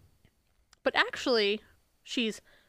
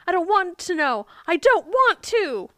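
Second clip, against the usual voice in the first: high, loud mock protest, slowing at the end; retold shout; 8 semitones higher, 7 dB louder than usual. Retelling Michelle's answer to her heavenly mother, raised shouting voice. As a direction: shout it out, imitating a distressed refusal. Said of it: "And Michelle shouts".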